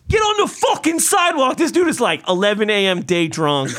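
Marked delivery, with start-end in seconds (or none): 0.1-1.6 high-pitched angry heckle; imitated yelling. Drunk passenger yells at cyclist, imitated shout. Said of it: "he's yelling".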